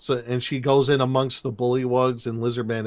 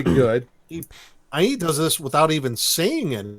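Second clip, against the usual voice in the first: ordinary delivery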